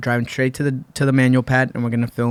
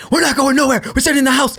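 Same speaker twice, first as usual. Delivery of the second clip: high, loud panicked outburst; mock panic, voice jumping high and loud; freaking out; 12 semitones higher, 3 dB louder, faster than usual. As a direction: play the friend losing it, pitch shooting up. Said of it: "And he's freaking out".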